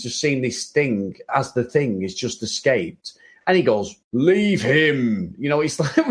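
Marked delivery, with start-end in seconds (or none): 4.1-5.3 hammed-up stage command with a swooping pitch; weird theatre voice. Imitating Doctor Doom's order to his guards, weird theatre voice. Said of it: "theatre voice".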